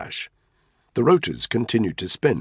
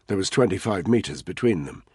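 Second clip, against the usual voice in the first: ordinary delivery, nothing marked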